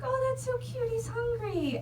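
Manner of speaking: put-on high, cooing baby-talk voice. To the turkey at the restaurant, cooing in a high voice. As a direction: gush over him like a cute pet.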